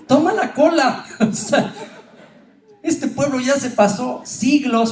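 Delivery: high, loud laughing rebuke; laughing scold. Real, not acted. Preacher laughing as he tells arrogant converts to wait their turn. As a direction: laugh while telling them off.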